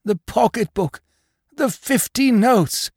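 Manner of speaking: high, anguished lament; moaning. A narrator's reading. Husband tells wife the notes are gone, moaning. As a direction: moan each loss out, aghast. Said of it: "he moaned".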